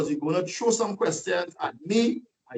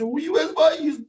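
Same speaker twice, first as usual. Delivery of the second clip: mocking taunt; 8 semitones higher than usual. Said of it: "They started to laugh at him and say".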